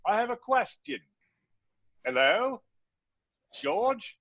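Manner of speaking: high-pitched, drawn-out comic calling; squeaky tentative caller hailing George, voice swooping up; office-hours caller. Acted. Caller dropping in on George's virtual office hours, high comic calling voice. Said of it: "you have to start that way".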